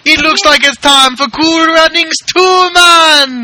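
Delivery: high, slow, booming hype voice; big excited sequel pitch; hyped announcer. Pretend. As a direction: sell it like a movie trailer.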